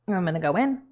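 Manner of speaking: talking with a thumb in the cheek; muffled, fingers hooked in mouth; muffled by hand in mouth. Real, not acted. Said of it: "it's hard to talk with my hand on my mouth".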